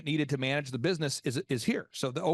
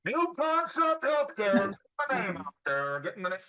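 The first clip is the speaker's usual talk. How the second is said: loud sharp voice; 4 semitones higher than usual. Retold or imitated. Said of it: "Why are you yelling at me?".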